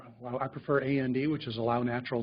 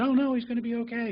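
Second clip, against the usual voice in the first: breezy denial; 9 semitones higher than usual. Retold or imitated. Family member refuses to accept decline.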